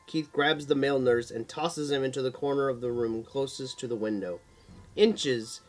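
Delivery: ordinary delivery, nothing marked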